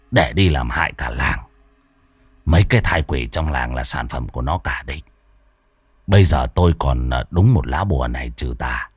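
an old master delivering bad news; low, solemn sorcerer's warning; grave old exorcist Tam. Lão Tam explains to the couple.

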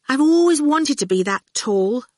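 high-pitched, wistful longing; yearningly. A narrator's reading. Fifi wishes she looked like Dillion, yearningly. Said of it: "Fifi said yearningly".

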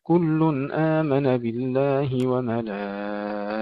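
slow, drawn-out tartil chant; Quranic recitation. Acted. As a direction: recite each phrase slowly with measured pauses. Recites the end of al-Baqara. (تلاوة مرتلة بطيئة)

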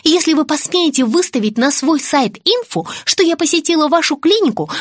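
offended diva laying down the law; indignant threat. Acted. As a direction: pile up the threats, ending on a curt farewell. Blonde celebrity threatens to sue, storms off.

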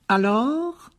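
aigu, lent, doucereux et menaçant; Rogue menaçant. A narrator's reading. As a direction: savourer chaque mot avec mépris. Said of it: "dit-il sans élever la voix". (professeur menaçant, voix doucereuse)

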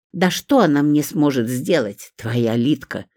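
ordinary delivery

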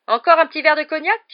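loud, high, panicky offer; exclamation affolée. A narrator's reading. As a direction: cry it out to change the subject fast. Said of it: "s'écria l'oncle Vernon qui était devenu livide". (cri paniqué, voix haut perchée)

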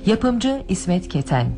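ordinary talk

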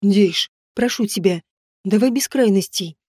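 ordinary delivery

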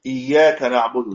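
إياك with the ya doubled and held; shadda held. Shown as a demonstration. Teacher showing the doubled ya in إياك. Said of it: "لابد يقرأها هكذا".

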